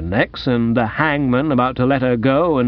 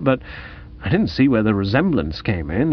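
ordinary delivery, nothing marked

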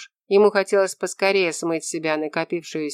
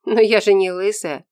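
plain reading voice

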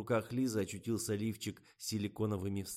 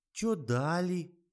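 sulky; 11 semitones higher, 8 dB louder, much slower than usual. A narrator's reading. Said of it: "недовольно оттопырила губу модель".

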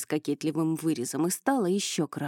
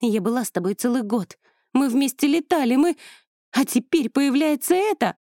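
angry outburst; 6 semitones higher, 6 dB louder than usual. Acted. Ingrid's jealous protest.

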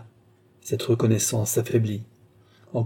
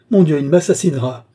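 loud, higher cry of terror and fury; screaming; 5 semitones higher, 8 dB louder than usual. A narrator's reading. Young woman fears her captor will kill her, screaming. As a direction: howl it out at a peak of anger. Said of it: "hurla la jeune femme".

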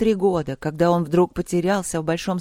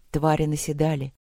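ordinary delivery

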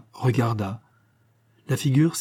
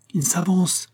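exclaimed; 7 semitones higher than usual. A narrator's reading. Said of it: "s'écria Shirley".

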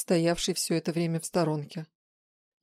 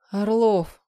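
ordinary delivery, nothing marked

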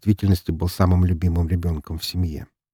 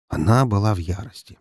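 ordinary delivery

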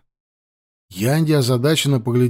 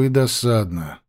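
plain reading voice

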